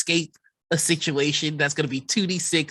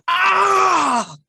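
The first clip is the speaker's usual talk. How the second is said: high, loud pained scream; scream of agony; 9 dB louder than usual. Acted. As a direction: cry out as if burning. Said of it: "you hear just a scream of agony".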